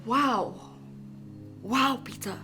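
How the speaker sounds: low, very soft disbelief; stunned disappointment. Acted. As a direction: let it fall out, hushed and hurt. Wife stunned by husband's accusation.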